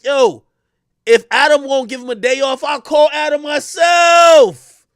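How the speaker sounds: building to a slow loud shout; mock loud threat. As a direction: raises his voice to threaten a call.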